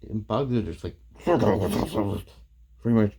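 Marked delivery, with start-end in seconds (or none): 1.2-2.2 wordless mock grumble; imitated grumbling. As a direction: grumble in garbled nonsense sounds.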